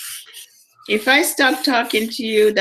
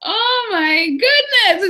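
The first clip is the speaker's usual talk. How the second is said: stunned realization; 13 semitones higher, slower than usual. Retold or imitated. Realizing she can say no.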